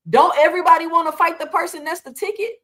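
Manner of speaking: ordinary talk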